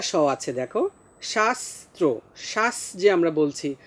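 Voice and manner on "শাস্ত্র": শাস্ত্র split slowly, dental s before ত্র; slow pronunciation demo. Shown as a demonstration. Teacher shows the two s sounds, slow careful articulation. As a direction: draw out শাস্ত্র as shas-tro. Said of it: "আমরা দন্ত স্বয়ের মতো উচ্চারণ করছি".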